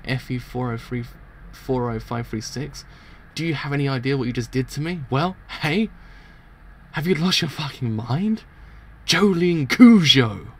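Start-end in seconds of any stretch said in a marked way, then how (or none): 3.3-8.4 indignant, rising challenge; worked-up confrontational questioning; acted outrage. Guard confronts prisoner over an attack. 9.1-10.5 loud, high, drawn-out yell; acted enraged roar; furious name shout. Enraged guard calls out prisoner's name.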